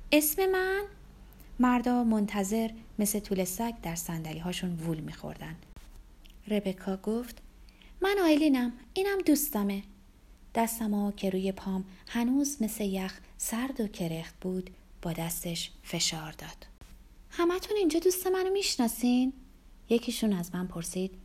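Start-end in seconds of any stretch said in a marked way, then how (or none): 0.1-0.8 very high questioning lift; innocent act. A narrator's reading. 8.0-9.8 high, louder woman's voice; lightly flirtatious introduction. Acted. Rebecca flirtatiously introduces herself. 17.3-19.3 much higher, lilting question; playful hostess. Acted. Rebecca addresses the table of men.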